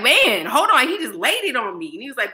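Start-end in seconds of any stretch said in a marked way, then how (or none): 0.0-0.9 raised, louder exclamation, half overwhelmed; exclaiming. Retold or imitated. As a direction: blurt it out higher and louder. Overwhelmed by his questions.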